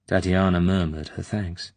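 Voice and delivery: ordinary delivery, nothing marked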